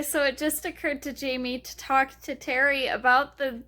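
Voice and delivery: ordinary delivery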